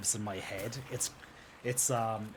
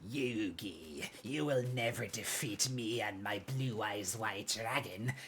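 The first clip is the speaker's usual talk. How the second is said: mock Kaiba taunt; slower than usual.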